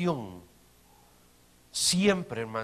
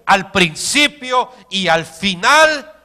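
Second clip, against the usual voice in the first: slow and louder, each word weighted; preacher stressing the phrase slowly; slow emphasis; 4 semitones higher, 13 dB louder, slower than usual. Acted. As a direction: lean hard on every word. Preacher slows on a key phrase.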